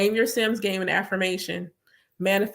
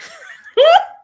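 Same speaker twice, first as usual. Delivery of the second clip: sudden high laugh breaking out; burst of laughter; 13 dB louder than usual. Real, not acted. Speaker laughing at her own realization about Sims. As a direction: laugh at yourself mid-sentence.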